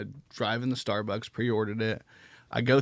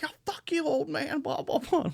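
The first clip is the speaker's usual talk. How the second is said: very high, squeaky mock tantrum; high-pitched mimicry of a furious young woman; mocking angry girl; 3 dB quieter than usual. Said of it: "Starts screaming".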